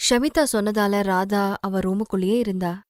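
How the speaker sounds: ordinary delivery, nothing marked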